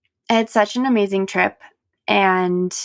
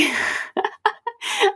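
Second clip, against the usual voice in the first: short high laugh; light laugh; 12 semitones higher than usual. Real, not acted. Laughs after yawning apology.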